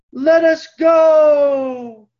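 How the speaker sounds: booming drawn-out cry of "let us go"; hammed-up epic prophet shout; Charlton Heston. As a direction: thunder it like a movie Moses. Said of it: "what Charlton Heston said".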